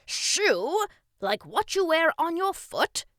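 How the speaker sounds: loud, high, eager instruction; teaching king. Acted. King teaches boy next sound, loud and eager. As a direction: project the lesson enthusiastically.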